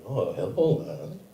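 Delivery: comic Scottish seductress; Scottish accent. Acted. Imagined Scottish woman flirting on a golf course, Scottish accent. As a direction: play a flirty Scotswoman with a Scottish lilt. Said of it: "when I do a Scottish accent".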